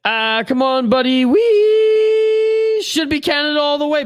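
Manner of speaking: loud, high, slow rallying call; excited rally cry. Real, not acted. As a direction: shout it out for the whole country. Hypes Canada to chat.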